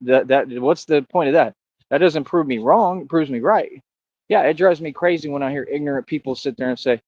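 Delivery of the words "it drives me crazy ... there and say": normal talking voice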